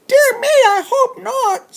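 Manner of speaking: very high squeaky voice with a nervous laugh; tiny chipmunk voice, laughing. Acted. Chipmunk laughing off Bear's warning, high squeaky voice. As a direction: giggle it out high and small.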